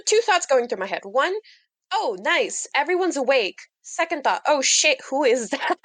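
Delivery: ordinary talk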